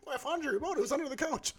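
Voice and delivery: high-pitched mimicked cleaning lady. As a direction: put on a high, helpful woman's voice. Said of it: "cleaning lady comes and she'll be like".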